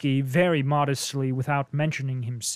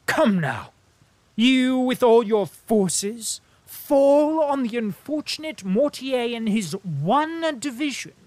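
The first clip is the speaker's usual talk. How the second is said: higher, louder ironic needling; a diplomat's sardonic jibe, raised and louder; mocking diplomat Bilbin; 8 semitones higher, 6 dB louder than usual. A narrator's reading. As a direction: tease him about a hollow win. Bilbin mocking Andrew's victory.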